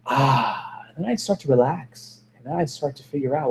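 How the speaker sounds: soft, relieved sigh of calm; quiet relief as the mind clears. Retold or imitated. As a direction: let the tension drop out of the voice. Relief after canceling thoughts.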